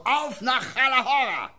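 loud, high, slow rallying cry; loud proclamation. Retold or imitated. Drunk regular leaving the pub, calling out loudly. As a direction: call it out grandly from the door. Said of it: "sagt ganz laut".

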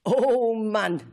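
plain reading voice

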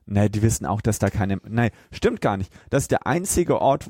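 ordinary talk, nothing marked

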